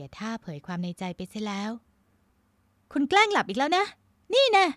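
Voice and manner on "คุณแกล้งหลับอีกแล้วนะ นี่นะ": loud, high, exasperated outburst; high-pitched huffy accusation; indignant scolding. Acted. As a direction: scold a teasing partner caught faking. She catches him faking sleep.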